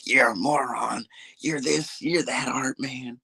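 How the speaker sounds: slow, whispered mock of the old man. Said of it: "get on the microphone and start".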